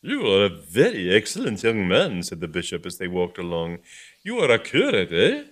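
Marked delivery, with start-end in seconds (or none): 0.0-2.2 bishop voice. Acted. Bishop praising curate. 4.2-5.4 bishop voice. Acted. Bishop questioning curate.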